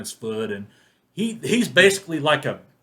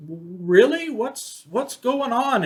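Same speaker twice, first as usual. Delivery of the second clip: mock-bewildered high questioning; clueless dad; 11 semitones higher, 4 dB louder, much slower than usual. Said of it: "he's just real clueless".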